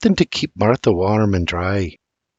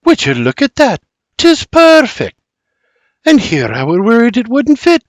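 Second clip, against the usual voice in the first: much higher, slowed 'Tis perfect, chirpy Scots; cheerful mother, higher and louder; delighted mother; 9 semitones higher, 3 dB louder than usual. Acted. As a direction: lighten the mood with cheer. Berta lightens the moment.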